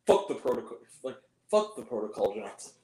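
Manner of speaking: low imitation, fading very soft; underplay the defiance, low and dropping away; understated movie line. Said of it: "More like that".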